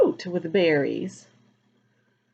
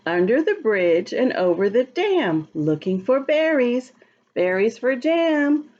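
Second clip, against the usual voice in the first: put-on reading to children; 3 semitones higher than usual. Rereads the rhyme.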